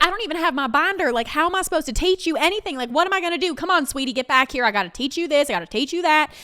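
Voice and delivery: fast, high, loud scramble; bossy Southern bartender in a flap; frazzled rush. Retold or imitated. Amanda hurrying the new girl behind the bar, fast and high.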